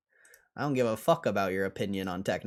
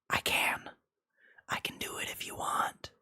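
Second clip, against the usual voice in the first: right in your ear; 13 semitones higher than usual. Pretend. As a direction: whisper teasingly into the ear. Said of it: "I can whisper in your ear".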